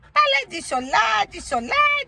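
put-on high, wailing baby-talk sob; mock crying wail. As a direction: pretend to cry along with the whimpering pet. Mimicking the whining pet.

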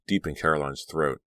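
ordinary delivery

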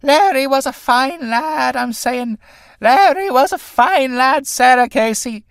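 put-on slow drunken shout; tipsy shouting. A narrator's reading. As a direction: bellow it out tipsy and slurred. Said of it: "Mary, suddenly shouting behind tipsily".

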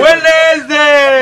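Wednesday stretched very slowly; drawn-out drill. Shown as a demonstration. Teacher drilling pupils, stretching Wednesday out very slowly. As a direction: spell Wednesday out slowly for the class. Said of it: "teacher drills the word".

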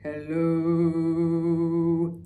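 long drawn-out low hello. Shown as a demonstration. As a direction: drop it down low and slow. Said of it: "your low voice".

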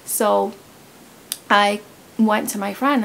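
ordinary talk

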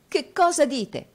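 high, loud cry of disbelief; shocked exclamation. A narrator's reading. Nicola reacting to Newman's revelation, shocked exclamation. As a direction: demand it in alarm. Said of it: "esclamò Nicola".

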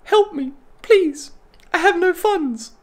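very high, pitiful little plea; mock begging. Creator begging viewers for money, mock high plea. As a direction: squeal for help in a hammed-up beg.

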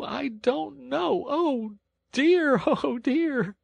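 very high, hammed-up weeping washerwoman; tearful wailing. A narrator's reading. Poor washerwoman begging sympathy from engine driver, tearful wailing. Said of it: "crying afresh".

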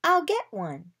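high, eager offer; excited cry. A narrator's reading. Drake volunteers to fetch the onion, crying out eagerly. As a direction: pitch it up, keen to help. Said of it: "Drake cried".

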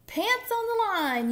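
high, loud carefree holler; yell. A narrator's reading. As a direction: sing out a high shout. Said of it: "yelled Duck".

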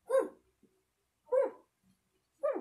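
short exhaled hu on each strike; kiai grunts. Shown as a demonstration. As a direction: snap out a hu per punch. (matinding hu sa bawat suntok)

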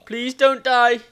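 urgent loud pleading. Real, not acted. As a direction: beg the pig not to die. Begging pig to survive.

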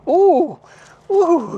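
normal talking voice

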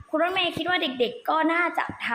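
ordinary talk

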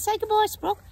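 high, sing-song pet talk; talking to the dog. Real, not acted. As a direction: coo at the dog.

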